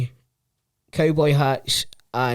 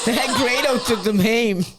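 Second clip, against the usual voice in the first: laughing imitation; 7 semitones higher, 3 dB louder, much slower than usual. Repeating the AI clip line.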